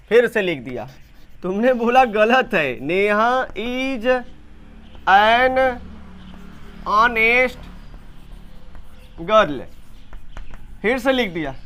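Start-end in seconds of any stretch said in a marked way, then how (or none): 2.8-9.6 slow, word by word; drawn-out "Neha is"; slow dictation. Shown as a demonstration. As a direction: read it out for copying. Reads corrected sentence to class.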